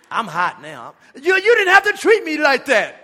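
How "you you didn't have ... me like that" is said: indignant protest. Retold or imitated. Barista protests after being splashed.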